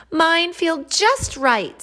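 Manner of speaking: high, slow and loud contentment; delighted. Acted. Corn delighted with the new fit.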